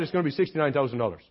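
put-on fast, low Irish accent, words run together; Luke Philpott. [name] quoting the repair price, rapid thick Irish accent. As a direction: toss it off quickly in a thick Irish brogue. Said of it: "Your accent's a little thick".

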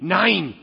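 "Nein" loud and sharp; German nein. Shown as a demonstration. Pastor mimicking German speakers, loud "Nein". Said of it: "they say".

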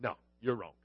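flat, low, quiet deadpan brush-off. Retold or imitated. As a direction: drop it low and soft, unimpressed. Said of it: "that deadpan".

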